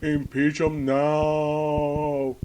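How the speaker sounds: hammed-up political outcry, every word stretched; mock outraged opponent. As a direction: howl it slowly like a hysterical protester.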